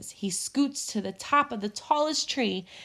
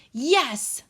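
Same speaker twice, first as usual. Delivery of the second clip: growled; 5 semitones higher, 4 dB louder than usual. A narrator's reading. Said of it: "Bear growled".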